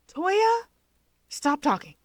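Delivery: high, loud flustered outburst; flustered yell. Acted. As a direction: cut him off, embarrassed. Flustered sister cuts off brother.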